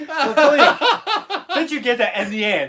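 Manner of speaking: high, loud laughing squeal of a question; laughing high-pitched tease. Pretend. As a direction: squeal it through laughter, teasing her. Mock-teasing repeat of question.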